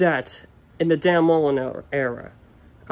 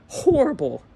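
"horrible" pitched up high and stretched; emphatic horrible; 7 semitones higher than usual. Real, not acted. Fan judging this year's defense, pitched up high in disgust.

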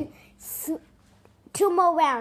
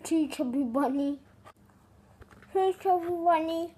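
cheeks stuffed full. Real, not acted. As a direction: mouth packed with marshmallows. Marshmallows stuffed in mouth.

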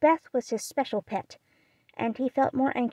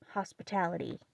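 plain reading voice

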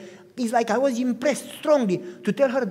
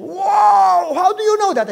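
amazed exclamation; 11 semitones higher, 12 dB louder, slower than usual. Retold or imitated. Lady amazed by his knowledge.

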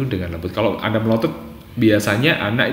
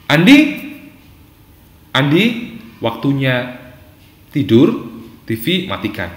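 measured, gently firm parent; firm but gentle; 3 semitones higher, 3 dB louder than usual. Shown as a demonstration. Parent telling Andi it is bedtime, firm but gentle. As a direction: give the order calmly, with pauses between phrases. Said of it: "ketegasan Anda dengan suara yang lembut".

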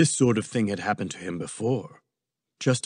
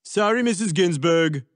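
blurted, higher interruption; blurting; 6 semitones higher, 3 dB louder than usual. A narrator's reading. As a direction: cut in with a glib apology. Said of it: "Trump blurted".

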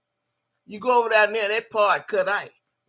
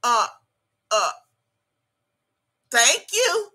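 mocking her thanking the nephew; mock thank you; 3 semitones higher, 3 dB louder than usual. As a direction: pitch way up to mimic her fake gratitude. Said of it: "Had it in her hand and said".

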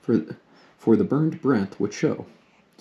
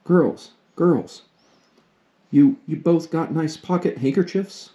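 high call from a window, a little louder; 7 semitones higher, 4 dB louder, slower than usual. A narrator's reading. Mother calls from the window to her daughters, raised and high. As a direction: call out after the girls. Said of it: "a voice cried from the window".

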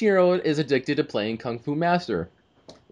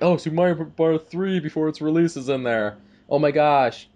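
raised, hammed-up excitement; mock amazement; 4 semitones higher than usual. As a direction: gush like an awestruck kid fan. Mimicking an excited film reaction.

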